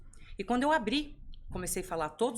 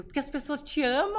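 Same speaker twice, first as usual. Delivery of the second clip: son's voice pitched higher and louder, delighted; excited child's answer; 7 semitones higher, 5 dB louder than usual. Retold or imitated. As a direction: play the little boy proudly explaining. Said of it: "Por quê, filho?".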